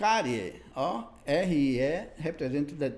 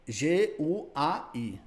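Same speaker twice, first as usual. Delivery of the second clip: G U A I spelled letter by letter, slow; G U A I dictated slowly, letter by letter; much slower than usual. Shown as a demonstration. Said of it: "completa embaixo".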